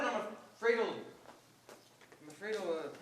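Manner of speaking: faltering, slowing into silence; stammering. Acted. Student pleading with surgeon, stammering to a halt. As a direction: start the sentence twice and falter. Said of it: "Back to the stammering again".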